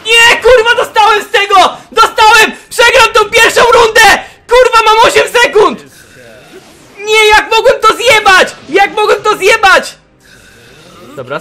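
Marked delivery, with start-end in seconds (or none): 0.1-9.9 high, loud wail of disbelief; frustrated screaming. Real, not acted. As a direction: scream in despair at blowing it. Blew the round, wails.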